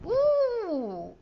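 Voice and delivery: high, loud startled gasp; startled ooh. Acted. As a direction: gasp in sudden surprise. Snake creature pokes out.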